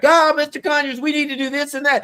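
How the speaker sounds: high, loud mock yell from the back of the room; imitating a screamer. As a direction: call out like a heckler at the back, half-hammed. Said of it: "somebody started screaming in the back".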